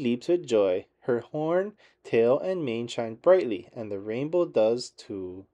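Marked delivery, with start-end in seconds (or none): none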